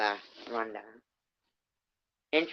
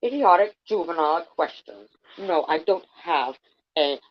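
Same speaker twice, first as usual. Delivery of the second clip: higher, louder attempt at an English accent for Bunny; 5 semitones higher, 6 dB louder than usual. Reader voicing Bunny's complaint in an English accent. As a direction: sneer it out in a put-on English accent. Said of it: "do it in an English accent".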